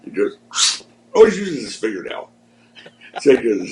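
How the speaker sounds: ordinary talk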